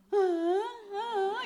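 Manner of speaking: high loud cry; fake child crying. Grandchild crying on purpose, loud high wail. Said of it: "이렇게 해".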